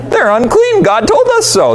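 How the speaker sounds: high of-course tone; matter-of-fact. Retold or imitated. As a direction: say it as something obvious.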